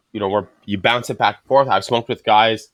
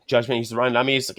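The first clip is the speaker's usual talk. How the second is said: ordinary talk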